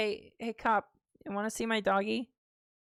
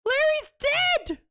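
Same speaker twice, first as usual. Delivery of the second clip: high, slow, loud screamed wail; hammed-up wail; 4 dB louder, slower than usual. Game character wails that Larry is dead, hammed-up scream. Hot dog seller screaming.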